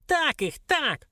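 very high squeaky dwarf cheer; high-pitched, gleeful urging; egging on. A narrator's reading. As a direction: cheer on in a tiny imp's voice. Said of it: "подбодрил старичок".

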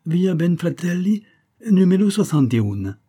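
put-on high, loud Italian-accented announcement; grand Italian shopkeeper's flourish; proud. A narrator's reading. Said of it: "expliqua-t-il avec orgueil".

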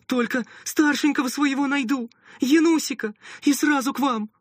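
high, loud hurried call; calling back while running. A narrator's reading. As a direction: shout it on the move. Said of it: "уже на бегу ответила женщина".

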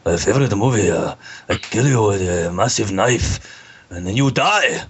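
caricatured Japanese accent; hammed-up, badly faked Japanese accent. Shown as a demonstration. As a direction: put on a movie-villain Japanese accent. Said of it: "I can do a Japanese".

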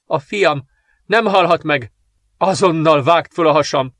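high, anguished, desperate order; tearful yelling. A narrator's reading. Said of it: "ordította zokogva". (kétségbeesetten, sírósan kiáltva)

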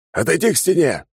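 high, harsh shouted command through a mask; masked soldier order. Acted. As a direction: bark the order like a masked trooper.

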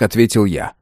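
ordinary delivery, nothing marked

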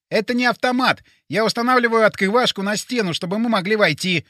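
high, insistent character voice; urgent Boris. Acted. Plays Boris.

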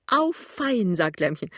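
high, loud burst of delight; delighted exclamation. Acted. Lämmchen hears his savings, delighted and high. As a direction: cheer at the good news.

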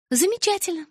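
prompt, high-pitched reply; prompt answer. A narrator's reading. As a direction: answer readily, pitched well up. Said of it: "без запинки ответила я".

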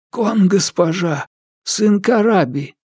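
high, lightly voiced ghost; meek ghost servant. Crippled ghost answers.

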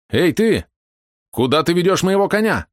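sharp high challenge; shouting. Acted. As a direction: call out to stop him. Hero confronts stranger leading horse.

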